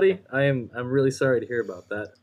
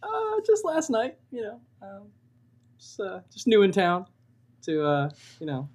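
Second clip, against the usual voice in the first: high, hesitant coy answer; pitched-up, evasive hemming; 7 semitones higher than usual. Pretend. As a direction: dodge the question coyly. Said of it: "You're being very coy".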